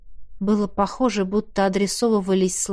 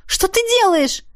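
high, panicked question; frightened; 11 semitones higher than usual. Acted. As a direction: cry out in rising fear. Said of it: "чувствуя нарастающий в груди страх".

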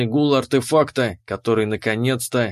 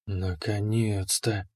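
slow ecstatic whisper, much softer; 5 semitones lower, 10 dB quieter, slower than usual. Acted. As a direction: breathe it out in rapture. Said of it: "прошептала она". (восторженный шёпот)

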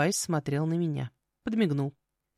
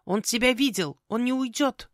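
high, urgent bird voice; croaking raven; 7 semitones higher than usual. Acted. Raven warning the girl about the visitor, urgent croak. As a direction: warn her in a pitched-up croak.

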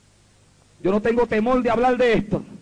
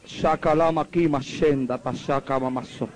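speaking in tongues; 8 semitones lower, 3 dB quieter, slower than usual. Real, not acted.

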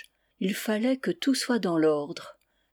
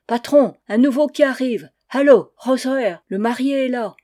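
loud, higher hail through cupped hands; hollering; 4 semitones higher, 8 dB louder than usual. Acted. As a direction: announce the newcomer to the whole ship. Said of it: "hurlait Kerouel, les mains en porte-voix".

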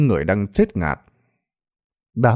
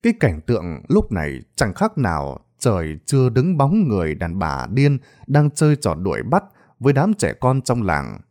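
plain reading voice

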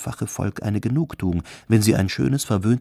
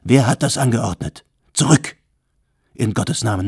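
louder, commanding shout at the crowd; stern shout; 5 dB louder than usual. A narrator's reading. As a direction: order them back, hard. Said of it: "rief er streng".

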